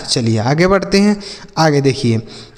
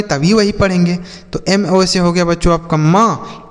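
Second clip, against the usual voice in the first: ordinary talk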